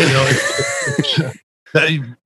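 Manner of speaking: fighting back tears as she begins; tearful laugh as a friend's story starts; choked up. Real, not acted. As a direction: voice cracking with tears, laughing through it.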